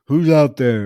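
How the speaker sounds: loud challenge, imitating a man outside; mock calling out. Drunk man outside with his gun, mock calling out. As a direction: call out loudly into the dark, as a retold line. Character calls into the night.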